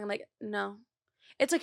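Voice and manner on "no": soft, withholding little no; quiet refusal. Retold or imitated. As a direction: act out going quiet when annoyed. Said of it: "that's what I do to my hoes".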